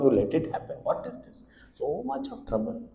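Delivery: hushed lament. Retold or imitated. Baby lamenting its troubles to God, hushed. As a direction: breathe it out in despair.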